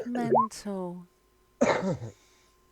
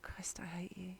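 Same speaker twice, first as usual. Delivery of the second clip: whispered under the breath; muttered grumble; 17 dB quieter than usual. Real, not acted. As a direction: mutter it very softly, almost voiceless. Mock grumbling at the dice result.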